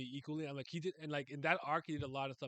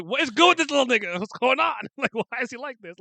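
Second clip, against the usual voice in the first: loud, high, mock-outraged demand; acted bewilderment; 9 semitones higher, 11 dB louder than usual. Fan re-enacting their reaction to a character, acted bewilderment. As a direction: re-enact being baffled, loud and high, laughing partway. Acting out own reaction to story.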